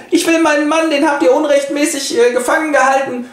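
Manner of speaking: high, insistent woman voice; demanding wife. Acted. The wife blocks Gessler and demands her husband, high insistent. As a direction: plead up high and firmly.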